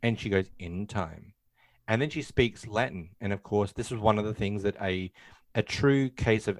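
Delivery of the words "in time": low, hushed, unhurried refusal; retold demon reply. Possessed girl calmly stalling the insistent priest, voiced low and quiet. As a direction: sink into a quiet, teasing answer.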